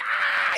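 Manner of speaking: tiny high-pitched creature scream; very high mock shriek; little scream. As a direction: squeal thinly, like something trapped in a box. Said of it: "like, a little scream inside".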